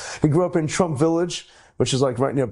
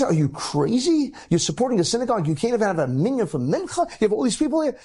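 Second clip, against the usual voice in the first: indignant scolding; 8 semitones higher than usual. Retold or imitated. Scolding the bingo chairman.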